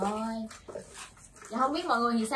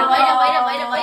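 excited; 12 dB louder than usual. Real, not acted. Enthusiastic agreement.

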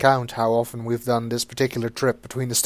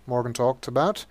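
ordinary talk

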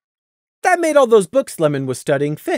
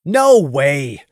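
mock protest, a bit louder; 3 semitones lower, 3 dB louder than usual. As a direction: playfully object to his friend. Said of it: "Fiera protested".

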